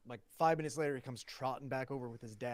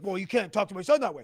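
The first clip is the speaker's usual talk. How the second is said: loud, higher-pitched mock confrontation; imitating upset dad; 6 semitones higher, 13 dB louder, faster than usual. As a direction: play an indignant dad challenging someone. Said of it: "getting upset and not leaving".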